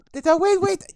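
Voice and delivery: high, loud startled guard; startled shout. Acted. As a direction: yell out in alarm. Game master voices startled guard.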